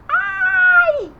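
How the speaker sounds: high, loud "ouch" yelp; hammed-up high yell of pain; mock cry of pain. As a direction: puppet yelping over a hurt finger. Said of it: "o que que a letrinha A falou".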